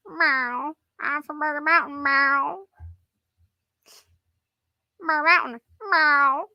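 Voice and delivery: a cheeky cat announcing where it's from. Pretend. Voicing the Murder Mountain cat.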